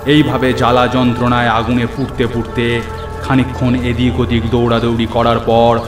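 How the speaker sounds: ordinary delivery, nothing marked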